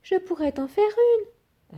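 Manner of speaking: lightly voiced old woman, high and slow; a hint of a frail granny, slowly. A narrator's reading. Granny offering her husband a cake, lightly voiced old woman.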